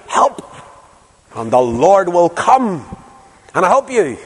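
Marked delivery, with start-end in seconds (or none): none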